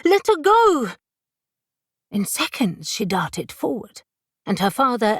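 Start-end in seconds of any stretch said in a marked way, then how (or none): 0.0-0.9 high, loud shout to stop her. A narrator's reading. Giselle shouts at the ghost to stop, high and loud. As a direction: yell it out sharp to halt the ghost. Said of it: "and then suddenly shouted".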